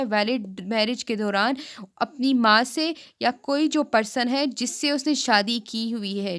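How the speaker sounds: normal talking voice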